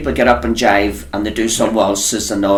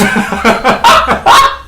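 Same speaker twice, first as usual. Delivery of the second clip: breathy laugh; 10 semitones higher, 3 dB louder than usual. Real, not acted. Laughs at her own story.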